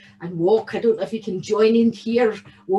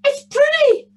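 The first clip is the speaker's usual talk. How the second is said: excited gasp; 9 dB louder than usual. Acted. Boy hears his cow.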